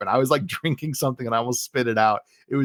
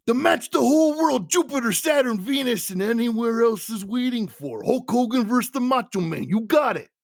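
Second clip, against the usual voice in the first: high, strained wrestler promo voice; Macho Man; 6 semitones higher than usual. Retold or imitated. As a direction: deliver a hammy wrestling promo in imitation. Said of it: "Mach, you know, goes back on the mic".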